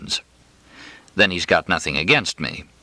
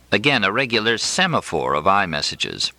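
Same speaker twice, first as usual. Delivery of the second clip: ordinary delivery, nothing marked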